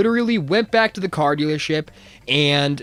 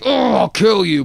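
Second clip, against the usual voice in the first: threat; 7 semitones higher, 7 dB louder, slower than usual. Retold or imitated. Angry man threatens.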